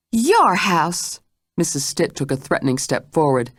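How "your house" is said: higher, scornful question; incredulous challenge. Acted. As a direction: challenge her claim. Mrs Stitt challenges.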